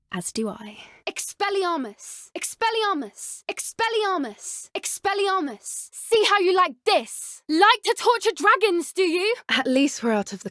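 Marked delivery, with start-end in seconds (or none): 1.0-5.9 higher, slow incantation; higher, drawn-out battle cast; spell cast. Acted. Casting spell in combat. 5.9-9.4 higher, louder furious jeer; higher, louder vengeful taunt; angry taunt. Acted. Taunting dragon poachers in fight.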